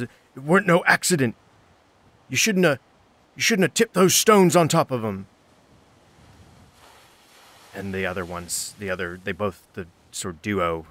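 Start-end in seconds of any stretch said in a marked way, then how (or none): 0.0-5.3 loud, high acted accusation; angry outburst. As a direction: break out at her, fast then insistent. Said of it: "kind of breaks out into her".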